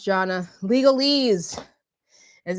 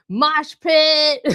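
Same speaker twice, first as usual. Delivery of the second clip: loud laughing hype; excited laughing shout; 5 semitones higher, 5 dB louder than usual. Real, not acted. Hyped about music.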